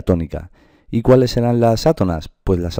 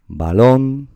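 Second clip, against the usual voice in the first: "balón" raised and loud on the final "lón"; stress on LON; 5 semitones higher, 4 dB louder than usual. Shown as a demonstration. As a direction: lift and punch the end of "balón". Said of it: "la sílaba tónica será lon".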